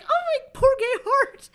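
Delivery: very high, loud, slow wail; mock heartbreak. Hearing the HIV test news, a very high slow wail. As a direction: swoon in comic despair.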